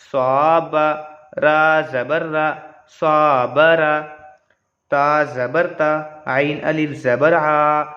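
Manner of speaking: slow, steady letter-by-letter reading; patient classroom recitation; Qaida recitation. Shown as a demonstration. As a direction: spell out each syllable for the learner. Reading the Qaida line aloud.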